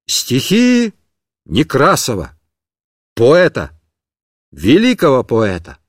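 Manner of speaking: loud declaiming. Acted. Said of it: "громко начал".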